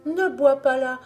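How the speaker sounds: high, loud, urgent warning; frightened sister's high-pitched outcry; alarmed cry. A narrator's reading. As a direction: plead loudly with him to stop. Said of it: "s'écria-t-elle".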